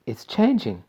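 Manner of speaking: plain reading voice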